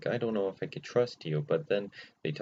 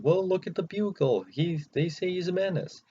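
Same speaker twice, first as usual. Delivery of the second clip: know-it-all bystander; 9 semitones higher than usual. Retold or imitated. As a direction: point to the paper like it's obvious. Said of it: "And I was like".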